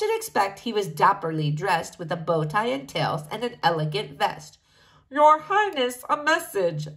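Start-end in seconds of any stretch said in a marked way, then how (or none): 5.1-6.9 formal, intoned announcement; intoning butler. A narrator's reading. Butler announces a message to the king, intoning. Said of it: "the butler intoned".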